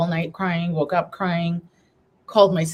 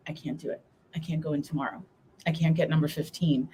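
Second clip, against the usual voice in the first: softer, subdued retelling of giving up; quiet defeat; 3 semitones lower, 7 dB quieter, faster than usual. Retold or imitated. Patient dreading the next infusion, quietly defeated. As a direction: drop to a small, defeated voice.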